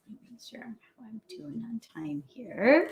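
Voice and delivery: whispered time check to herself; whispered aside. Real, not acted. Speaker checking the clock mid-talk, whispered aside. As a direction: drop to a whisper, mind elsewhere. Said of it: "checking time aside".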